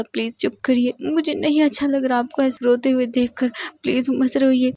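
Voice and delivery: a lover crying while begging his partner to stop crying; crying plea. Acted. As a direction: plead through your own tears. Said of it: "वी Ying भी बहुत बुरी तरह से रोने लगता है".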